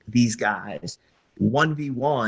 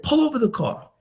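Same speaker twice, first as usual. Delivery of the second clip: sharp, much higher command; demanding; 13 semitones higher, faster than usual. Retold or imitated. Kobe turning angry, demanding the car stop. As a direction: order him to stop the car. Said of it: "he starts to get angry".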